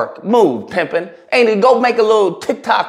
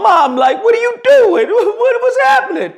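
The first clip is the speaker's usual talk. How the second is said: put-on high, squeaky, frantic kid voice; kid's panicked voice. Kid pushing his mom off the YouTuber, squeaky and frantic. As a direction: whine it out like a confused little boy. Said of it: "Little James comes over and says".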